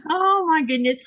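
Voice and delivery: laughing through the words. Real, not acted. Laughing after Jaja's line.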